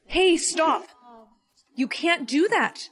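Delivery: sharp alarmed yell to stop her. Acted. Mom yells at the girl to stop her fighting, loud. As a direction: shout to stop her cold. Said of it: "I didn't mean to yell".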